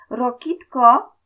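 loud, high, drawn-out taunt; teasing singsong holler; mocking call. A narrator's reading. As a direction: call out slowly, making fun of him. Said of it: "zawołał kpiąco".

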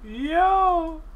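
high, loud, incredulous squeal; real high-pitched astonishment; hysterical amazement. Real, not acted. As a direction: squeal in delighted disbelief. Amazed at turning into a cow.

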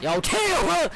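sudden panicked shout; high panicked yelp. Real, not acted. Sudden yelp during fight.

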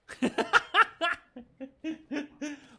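high, loud burst of laughter; amused laughter. Real, not acted. Amused by the other player's antics.

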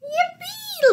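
high-pitched yippee; gleeful cheer. Acted. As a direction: cheer it high for small children. Cheering as Fish appears.

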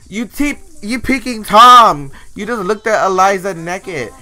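loud, high-pitched indignant accusation; outraged yell. Acted. Player scolding a sim who walked in on them, outraged yell.

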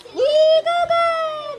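high, long-drawn cheer. Real, not acted. Urging baby to bite the corn, coaxing baby talk. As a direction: stretch the first word high to coax.

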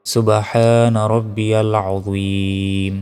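"আযীম" held very long on the ee; long final stretch. Shown as a demonstration. Teacher correcting the tasbih, slow recitation with held ending. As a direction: slowly recite "সুবহানা রব্বিয়াল আযীম", stretching every long vowel. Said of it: "এভাবে তিনবার পড়তে পারবেন".

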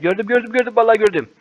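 ordinary delivery

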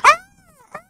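very high shrieking pterodactyl cry. Acted. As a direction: shriek like a flying reptile. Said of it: "Pterodactyls were like".

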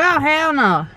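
high, outraged hell no; indignant hell no. Retold or imitated. Seeing the carved initials, a high outraged refusal. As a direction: refuse it flat out, pitch up. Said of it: "So I was like".

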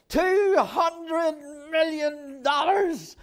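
slow, stunned, high-pitched gasp of disbelief; shocked disbelief. Retold or imitated. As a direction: repeat the number in disbelief. Young guy shocked by amount.

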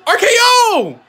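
high, loud hype call; excited shout. Real, not acted. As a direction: yell the move name at the screen. Calling the wrestler's finishing move.

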